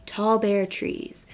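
ordinary delivery, nothing marked

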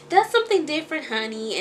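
mock sweet teacher. Teacher voiced in retold story.

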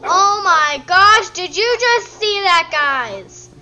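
loud, drawn-out disbelief; astonished yell to viewers; shocked outburst. Real, not acted. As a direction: exclaim it loudly in shock. Shocked by event in game.

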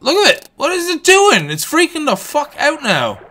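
very high, loud amazement; loud, high excited yelling; excited outburst. Real, not acted. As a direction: burst out in high-pitched disbelief. Fish freaking out at lure.

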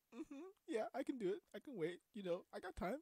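imitating a contented caller in a high voice; high-pitched, happily patient mimicry. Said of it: "just so content he is".

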